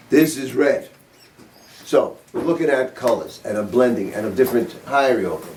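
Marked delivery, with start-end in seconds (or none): none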